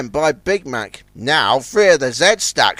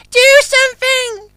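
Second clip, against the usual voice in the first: calling out; 5 dB louder than usual. A narrator's reading. The oyster barge calls to Sunshine for help, very high. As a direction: squeak it out in a panic. Pearl panics.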